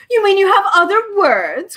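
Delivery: high, loud and delighted; happy cry. Acted. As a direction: pounce on it gleefully. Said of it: "cried the bird happily".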